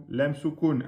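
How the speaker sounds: ordinary talk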